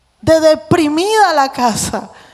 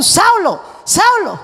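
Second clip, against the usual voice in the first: short, higher barked name; sharp shout; 4 semitones higher, slower than usual. Shown as a demonstration. Preacher showing the right reading, a shouted Saulo. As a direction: call him out like a shout. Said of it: "Era un".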